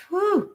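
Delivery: short, high, soft "whew"; winded whew. Real, not acted. Host got hot preaching to viewers, winded whew. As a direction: blow off the heat with a whew.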